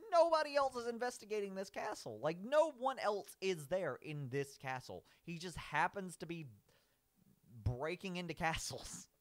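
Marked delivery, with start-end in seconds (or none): 0.1-4.6 incredulous rant. Real, not acted. Ranting about castles.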